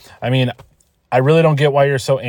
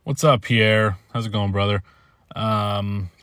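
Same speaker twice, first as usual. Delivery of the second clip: ordinary talk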